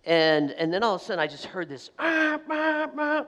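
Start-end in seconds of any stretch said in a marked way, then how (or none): 2.0-3.2 high honking noise voiced out; imitated car horn. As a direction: mimic a car horn blaring. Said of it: "just this honking".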